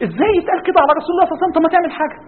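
high, fast, outraged protest; seething indignation. Acted. Preacher voicing a listener outraged for the Prophet, seething. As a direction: blurt it out as if boiling inside. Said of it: "أنت قاعد دلوقتي بتغلي".

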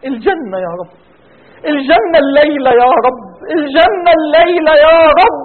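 rising, impassioned supplication, voice breaking into tears; fervent prayer. Real, not acted. Preacher leading the congregation in tearful prayer. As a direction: pour out a tearful plea to God.

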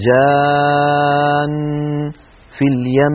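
"جان" hummed very long then "في اليم"; held ghunna. Shown as a demonstration. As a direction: hold the noon of "جان" as a long hum. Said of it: "نحو".

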